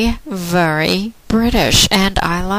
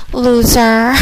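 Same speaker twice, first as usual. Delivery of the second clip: taunt; 5 semitones higher, 5 dB louder than usual. Real, not acted. Taunting the caller at the end.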